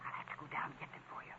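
very soft, hurried whisper; barely audible whisper. Acted. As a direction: whisper timidly so as not to disturb him. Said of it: "Stop whispering".

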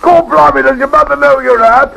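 bursts out as a put-on Cockney butler. Disguised gent surprising his friend in Cockney voice. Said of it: "my disguise as a Cockney butler".

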